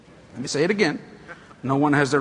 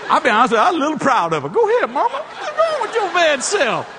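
laughing cheer; 10 semitones higher than usual. Real, not acted. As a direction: cheer her on through laughter.

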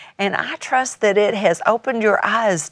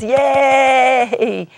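put-on high, drawn-out child's cheer; yay in a high little-kid voice; little grandkid; 4 semitones higher than usual. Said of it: "like a little, one of my little grandkids".

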